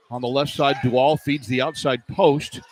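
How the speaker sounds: ordinary delivery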